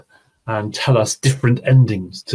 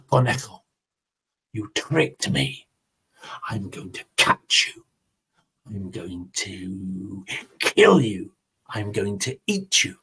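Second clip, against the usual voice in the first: menacing jaguar; 5 semitones lower, 7 dB quieter than usual. Acted. Jaguar threatens rabbit in story.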